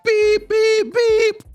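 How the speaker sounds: high, flat electronic beeps; mock electronic beep beep; imitated beeping. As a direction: mimic a collar alarm going off. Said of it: "their collars start going off".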